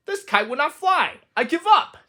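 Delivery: high, whiny giving-up voice; a fed-up little toad, high and whining; despairing Toad. Acted. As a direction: whine like you've had enough. Toad gives up on kite.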